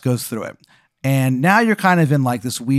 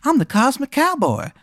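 very high-pitched stoner cowboy voice; high, loopy cowboy character; Cosmic Cowboy; 13 semitones higher than usual. Acted. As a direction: greet him high-pitched, as if just exhaling a big hit. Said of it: "He, like, takes a big hit".

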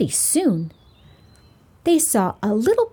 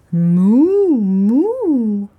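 cow moo; 5 dB louder than usual. Acted. Cow answers in story.

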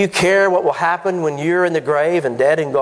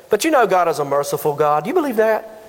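ordinary talk

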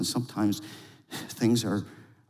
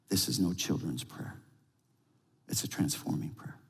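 soft preaching; 3 semitones lower, 6 dB quieter than usual. Acted. Soft preaching before prayer.

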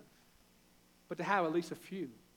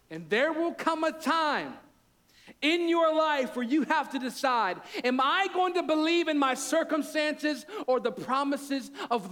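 much higher and louder, rising to a fervent climax; preaching at full force; 9 semitones higher, 6 dB louder than usual. Acted. Pastor challenging the congregation, fervent full-force preaching.

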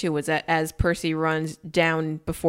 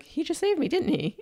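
much higher, amazed; amazed whisper-high; 11 semitones higher than usual. Retold or imitated. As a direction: marvel that he was saved. Percy voiced, amazed.

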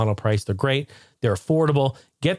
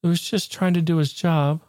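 imitating sweet wife; 2 semitones higher than usual. Wife feeling sorry for the cut cable, lightly mimicked pity. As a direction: plead gently for the poor cable. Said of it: "She goes".

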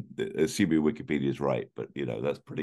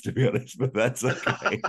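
laughing; 10 semitones higher than usual. Real, not acted. Laughing joke aside.

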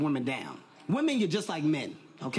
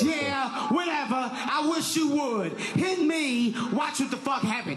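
taunting woman; 6 semitones higher, slower than usual. Retold or imitated. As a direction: goad him into hitting. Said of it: "you ever see that bitch?".

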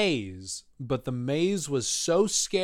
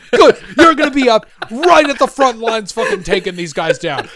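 loud, high mock sergeant giving orders; hammed-up army sergeant rallying a kid; drill sergeant; 11 semitones higher, 12 dB louder than usual. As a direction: pump up the recruit for the front line. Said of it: "And he's just like".

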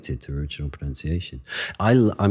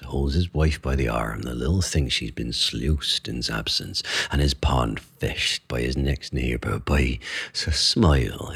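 Shakespearean original pronunciation; 3 semitones lower than usual. Shown as a demonstration. Reciting Leontes' speech for the podcast host, in Shakespearean original pronunciation. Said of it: "Leonti's speech in O P".

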